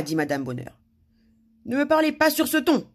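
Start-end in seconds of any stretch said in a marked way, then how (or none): 1.7-2.9 high, loud offended whine; moaning. A narrator's reading. King objects to being ordered about, moaning. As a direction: whine it, wounded. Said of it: "gémit le roi".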